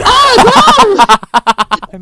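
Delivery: high, loud horrified yelp of no. Retold or imitated. Retold horrified reaction.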